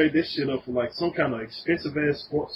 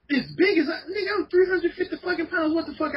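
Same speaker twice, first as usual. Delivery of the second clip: outraged shout; 12 semitones higher than usual. Real, not acted. Outraged about small car.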